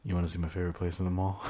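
low, soft creepy invitation. Retold or imitated. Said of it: "it really creepily is like".

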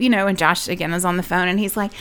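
ordinary delivery

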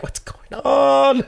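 very high, slow, stretched-out bewildered whine; mock bewilderment. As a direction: drag it out like you're totally lost. Panicked quote in Russia.

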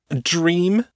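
high, sharp scornful question; spat angrily. A narrator's reading. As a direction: throw it back in anger. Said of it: "the other spat".

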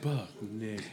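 echoey whisper. Retold or imitated. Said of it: "the echoey".

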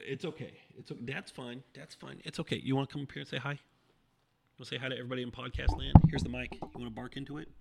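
hushed, sweet reassurance; coaxing a pet. Real, not acted. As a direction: gently coax a shy dog. Coaxes his sheepish dog.

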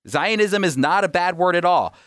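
normal talking voice

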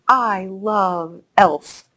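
put-on slow, drawn-out little girl's delight; lightly voiced little girl, fond; quoting a happy girl. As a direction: stretch it out like a child savoring the words. Girl quoted loving the program.

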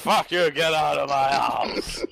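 cartoon caveman shouting; drunk Fred. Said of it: "That's a pretty spot on impression".

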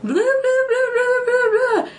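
high squeaky mocking voice; mocking blah blah. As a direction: mimic her nagging, high-pitched. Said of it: "I hear someone go".

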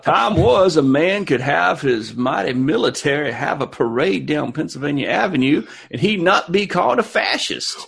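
put-on wax nostalgic in a Mark Twain accent. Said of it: "in that voice".

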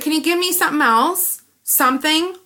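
high, pleading ask; rising plea. Retold or imitated. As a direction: beg for something, voice up. Pleading with the clerk.

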